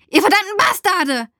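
high, loud outraged yell; enraged scream. A narrator's reading. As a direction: scream the curse at them in fury. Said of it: "schreie ich und meine Kehle fühlt sich rau an".

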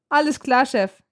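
jovial reply with a touch of amusement; mildly amused. A narrator's reading. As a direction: cheerfully go along with the joke. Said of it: "sagt Patrick lachend".

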